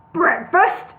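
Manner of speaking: loud booming disbelief; boomed. A narrator's reading. Boss booming at Larry's boast, loud. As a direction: boom the word back at him. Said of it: "boomed Al the Ale".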